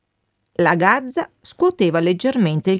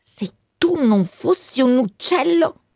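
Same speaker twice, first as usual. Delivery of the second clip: higher hissed threat from the wind; a wind hissing at a bird; 6 semitones higher than usual. Acted. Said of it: "sibilò Matteo". (vento Matteo che sibila una minaccia, voce più acuta)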